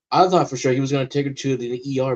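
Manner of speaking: ordinary talk, nothing marked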